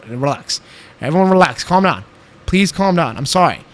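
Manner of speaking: ordinary talk, nothing marked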